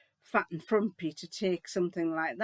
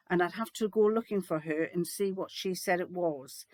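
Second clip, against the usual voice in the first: normal talking voice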